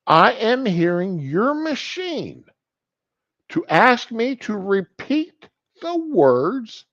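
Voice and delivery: slow, high, word-by-word mock indignation; pointed disbelief. Patient explaining to doctor what the machine said, acted indignant disbelief. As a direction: lay it out deliberately, pitched high. Patient spells out the shocking words.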